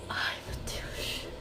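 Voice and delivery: slow breathless whisper; whispered oh my god. Real, not acted. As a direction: breathe it out, stunned. Reacting to the pregnancy news. (sussurro lento e espantado)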